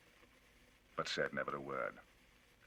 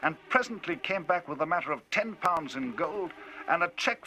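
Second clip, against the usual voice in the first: higher, louder, lively telling; Enfield, indignant storyteller; 10 semitones higher, 8 dB louder than usual. Acted. Enfield telling Utterson of the cheque, indignant and loud. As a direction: drive the tale on, indignant.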